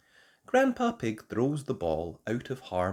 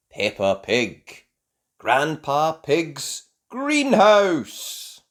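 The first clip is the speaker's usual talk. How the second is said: title announcement; 6 semitones higher, 10 dB louder, much slower than usual. Acted. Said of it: "announces the title".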